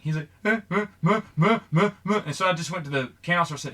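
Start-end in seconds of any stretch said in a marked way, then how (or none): 0.4-2.2 higher-pitched mock sobs in short bursts; mock boo-hooing, uh after uh; imitated crying. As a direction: imitate a kid who won't stop crying. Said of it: "kid just kept crying and crying".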